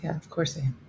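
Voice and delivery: whispered dog voice; voicing the dog. Owner voicing her deadpan dog in a whisper. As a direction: breathe it flatly with no voice. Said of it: "She's like".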